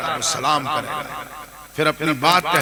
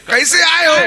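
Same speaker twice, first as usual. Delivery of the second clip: high, louder searching question; pitched-up voice of the saint asking; saint's question; 12 semitones higher, 8 dB louder than usual. Retold or imitated. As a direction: ask it high and loud, probing.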